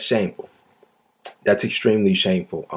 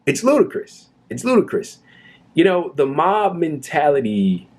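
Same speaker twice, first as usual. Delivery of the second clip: higher, incredulous outburst; exasperated; 6 semitones higher than usual. Real, not acted. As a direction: sound fed up and amazed. Outraged at police attitude.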